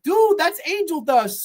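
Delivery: slow, high mock voice; drawn-out, high-pitched imitation of a warning; imitated smoker buddies. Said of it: "they're like".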